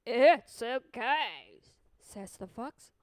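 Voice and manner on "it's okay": loud, slow, coaxing fox voice; sly reassuring fox. Acted. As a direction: soothe him slyly, slowly.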